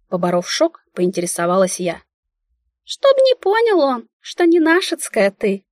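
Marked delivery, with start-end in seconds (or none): 2.8-5.6 very high, brisk mouse voice; businesslike squeak. Acted. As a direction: squeak it like a matter-of-fact mouse. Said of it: "деловитым тоном пискнула Мика".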